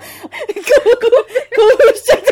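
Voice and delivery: can't get the words out for laughing; laughing through the words. Real, not acted. Reading the steamy line, breaking into real laughter. Reader cracks up mid-story.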